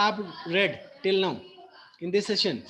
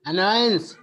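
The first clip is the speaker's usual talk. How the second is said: "annoyance", second syllable pushed louder; stress on second syllable; 6 dB louder than usual. Shown as a demonstration. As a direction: punch the -noy- in "annoyance". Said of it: "you are focusing on the second syllable". ("annoyance" के दूसरे syllable पर ज़ोर)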